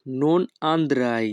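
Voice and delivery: each Italian word spoken slowly and clearly; teacher modelling Italian for learners, very slow; slow demo. Shown as a demonstration. Bengali teacher models Italian phrase for students, slow and careful. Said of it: "Italian phrase demo".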